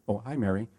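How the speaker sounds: quiet and gentle, matching her volume; soft matching reply. Shown as a demonstration. Greeting a soft-spoken Mary at her low volume. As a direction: softly echo her name back. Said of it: "volume level and tonality".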